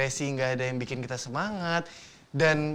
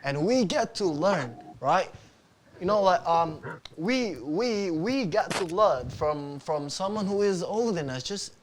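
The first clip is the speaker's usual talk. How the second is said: put-on speech in an Australian accent; 4 semitones higher, slower than usual. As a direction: deliver it like an Aussie speaker. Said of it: "menggunakan aksen Australia".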